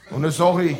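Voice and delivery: ordinary talk, nothing marked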